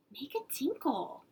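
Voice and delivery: high little whisper; whispered child. Acted. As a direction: whisper it like a shy small girl. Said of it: "Jeannie asked".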